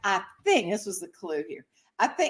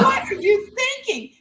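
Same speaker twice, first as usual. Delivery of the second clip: high-pitched incredulous scolding; mock-exasperated high squawk; retold exasperation. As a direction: act out scolding mama in disbelief. Daughter scolds mother after crossing.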